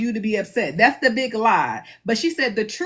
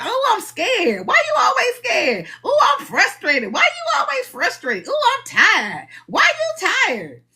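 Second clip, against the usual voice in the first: mock exasperation; 10 semitones higher than usual. Speaker mimics someone forever complaining and being questioned, mock exasperation. Acting out complaint and nagging reply.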